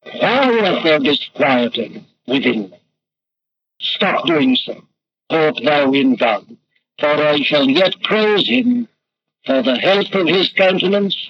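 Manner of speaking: slow, measured and grave; slow, weighty scripture recitation; solemn psalm recital. Retold or imitated. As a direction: speak the psalm over yourself deliberately. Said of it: "and say".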